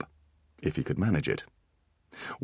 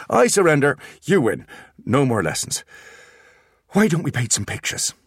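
louder exasperated giving up; loud surrender; 9 semitones higher, 6 dB louder than usual. Acted. Artemis surrenders to the twins, exasperated. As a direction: throw up your hands in defeat.

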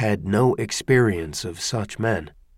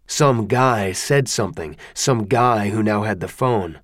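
ordinary delivery

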